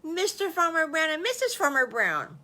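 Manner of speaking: high, loud, delighted announcement; thrilled child's big reveal; big-smile reveal. Acted. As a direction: proudly proclaim the names through a huge smile. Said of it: "with a great big smile on his face".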